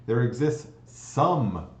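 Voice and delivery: slow down and hit some; stress on some. Shown as a demonstration. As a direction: dwell on the word some. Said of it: "we like to stress the word some".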